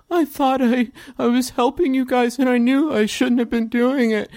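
put-on high, tearful child voice; crying little boy. Boy detective apologizes to the heroes, high crying voice. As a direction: blurt a confession like an upset kid. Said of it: "You hear Ango crying".

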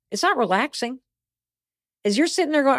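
usual speaking voice

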